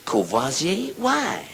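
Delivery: surprised question. Acted. Friend questions the name.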